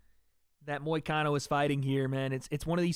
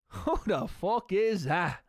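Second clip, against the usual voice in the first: put-on slow Irish accent through a laugh; Irish quote, laughing; slower than usual. Streamer quoting Conor while laughing, Irish accent. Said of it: "I know all the quotes".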